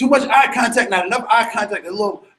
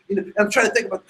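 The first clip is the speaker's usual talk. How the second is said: normal talking voice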